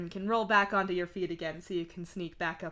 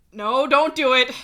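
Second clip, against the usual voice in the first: loud, higher cry to stop; urgent warning; 5 semitones higher, 13 dB louder than usual. Acted. Begging oneself not to crack up, urgent. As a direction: blurt a loud plea to stop.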